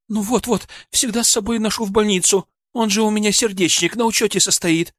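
high, anxious woman's voice; tearful patient's wife. Acted. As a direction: explain to the doctor, pitched high and upset. (встревоженная жена, высоким голосом)

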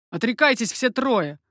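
moderately loud command; exclaiming. A narrator's reading. Coconnas demanding all three renounce, exclaiming. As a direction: order them with a lifted volume. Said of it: "воскликнул Коканнас".

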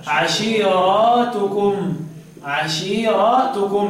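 عشيراتكم very slow, with a long aa after the r; recited عشيراتكم, plural ending drawn out; plural reading. Shown as a demonstration. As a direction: stretch عشيراتكم into 'ashiiraatukum. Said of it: "قال لك بالجمع صدق تبقى إيه؟".

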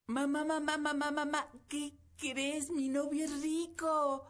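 high, loud, breathless excitement; excited girl. Acted. Daughter running in to tell her mother big news, high excited calling. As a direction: burst in calling mamá, thrilled.

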